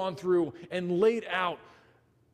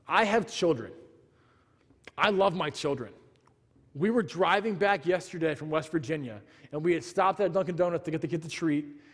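normal talking voice

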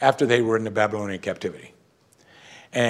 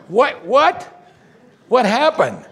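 much higher, louder, laughing innocence; mock innocent surprise; 13 semitones higher, 6 dB louder, much slower than usual. Spouse pretending to the pastor nothing happened, high surprised. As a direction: play dumb with a squeal of surprise.

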